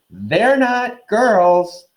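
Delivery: put-on high, slow, wailing ghost girl; ghostly Myrtle. Myrtle to the boys in her bathroom, suspicious and wailing. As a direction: drag it out like a sulky ghost.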